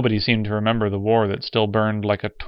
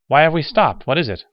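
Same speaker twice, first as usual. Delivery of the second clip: a higher, louder startled question; startled surprise; 7 semitones higher, 6 dB louder than usual. A narrator's reading. Lamar startled in the back seat, higher surprised question. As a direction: caught off guard, looking up from his tapes. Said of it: "a grunt of nearsighted surprise".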